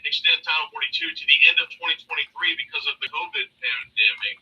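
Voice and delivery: ordinary talk, nothing marked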